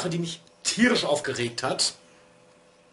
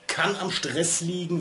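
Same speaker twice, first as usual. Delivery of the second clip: ordinary delivery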